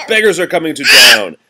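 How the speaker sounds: big loud announcing of the line; loud rhyme call. Acted. Parent reading to child, shouting the beggars line. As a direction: belt it out like town crier news.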